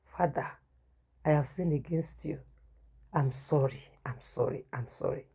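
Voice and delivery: soft, broken prayer, fading to a whisper; tearful prayer. Retold or imitated. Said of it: "I started crying".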